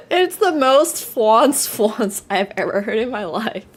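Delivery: helpless high laughter; laughing so hard it breaks. Real, not acted. Laughing fit.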